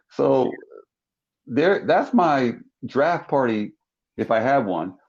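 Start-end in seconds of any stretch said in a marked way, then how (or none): none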